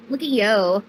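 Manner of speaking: ordinary delivery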